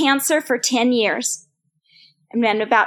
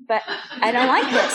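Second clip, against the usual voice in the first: laughing. Real, not acted. Laughing at her own complaint.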